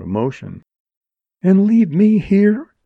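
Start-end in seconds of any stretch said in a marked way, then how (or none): 1.4-2.7 high, loud and slow disbelief; stunned question; incredulous. A narrator's reading. Jerry incredulous.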